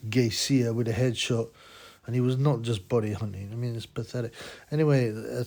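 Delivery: normal talking voice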